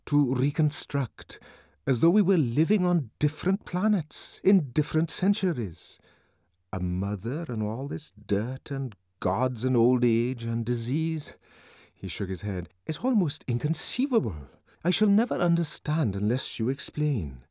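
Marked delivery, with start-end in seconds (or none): 0.1-11.4 Bernard's voice. A narrator's reading. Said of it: "Bernard was saying". 12.9-17.4 raised, puzzled voice; lightly voiced puzzled Bernard; Bernard's voice. A narrator's reading.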